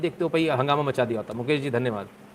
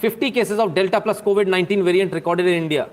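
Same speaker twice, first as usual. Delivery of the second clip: very slow, higher, louder, word by word; headline read; 3 semitones higher, 4 dB louder, much slower than usual. Pretend. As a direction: announce it like a solemn news anchor. Reading the updated headline.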